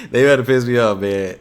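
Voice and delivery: words spilling out through real laughter; laughing while talking. Real, not acted. Laughing at absurd slang video.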